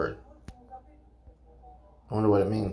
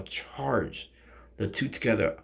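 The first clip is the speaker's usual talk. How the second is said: ordinary talk, nothing marked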